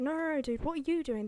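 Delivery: hammed-up indignant retort; mock protest. Acting out argument over trees.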